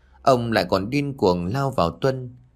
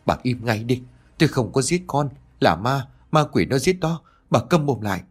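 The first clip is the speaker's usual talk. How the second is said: angry yelling; 4 semitones higher than usual. A narrator's reading. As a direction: silence her with a restrained yell. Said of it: "ông quát lớn".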